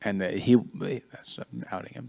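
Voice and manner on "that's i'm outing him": aside. Real, not acted. Aside about his son.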